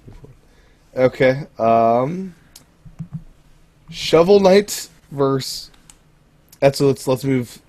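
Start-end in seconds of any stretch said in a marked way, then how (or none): 3.9-5.7 slow, drawn-out announcer call; fighting game announcer. Pretend. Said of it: "a good announcer voice".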